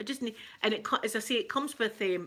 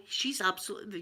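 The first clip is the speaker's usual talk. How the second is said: ordinary talk, nothing marked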